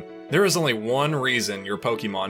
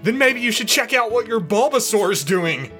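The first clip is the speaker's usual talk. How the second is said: high, loud comeback; hammed-up argumentative shout; taunting retort; 12 semitones higher, 7 dB louder than usual. A narrator's reading. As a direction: throw it back at full volume. Brock retorts in acted quarrel.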